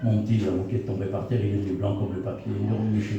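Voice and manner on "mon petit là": ordinary talk, nothing marked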